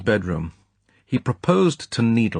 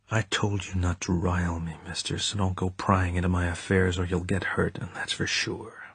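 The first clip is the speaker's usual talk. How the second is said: quietly menacing gangster; 7 dB quieter than usual. Acted. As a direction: warn him under your breath, barely containing rage. Scaramanga threatens Bond, barely controlled.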